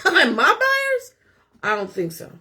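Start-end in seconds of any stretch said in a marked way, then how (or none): 0.0-1.1 indignant. Real, not acted. Indignant about lender.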